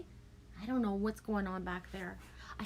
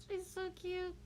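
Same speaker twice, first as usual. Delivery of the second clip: softer, hushed coo, still high; 10 semitones higher, 9 dB quieter than usual. Real, not acted. Grandparent softly cooing over the baby, hushed and high. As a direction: breathe it out tenderly.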